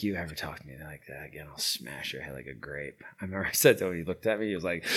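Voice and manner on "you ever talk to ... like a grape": low, soft menace, each word quietly deliberate; quiet threat. Retold or imitated. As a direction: threaten him quietly, no need to shout. Retold threat to attacker.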